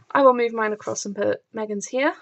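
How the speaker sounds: ordinary talk, nothing marked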